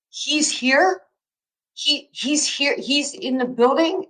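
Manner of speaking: high, breathless and star-struck; can't believe he's really here; stunned disbelief. Retold or imitated. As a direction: gasp out the news in high disbelief. Star-struck disbelief at meeting Ali.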